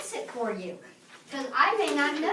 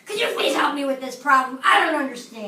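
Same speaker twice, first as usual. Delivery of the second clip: loud, angry demand for help; 9 dB louder than usual. Shown as a demonstration. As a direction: snap it out, louder and annoyed. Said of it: "My tone, same words, different tone of voice".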